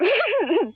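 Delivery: high, short mirthless laugh; bitter laugh. Acted. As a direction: laugh the joke off on yourself. Patient laughs at herself.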